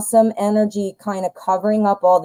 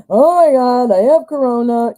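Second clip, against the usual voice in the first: a hammed-up gasp of alarm, a little higher and louder; mock panic; 4 semitones higher, 4 dB louder than usual. Sniffling streamer imitating her own panic over a sneeze. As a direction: play out your own hypochondriac freak-out. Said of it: "it's like I'm freaking out going".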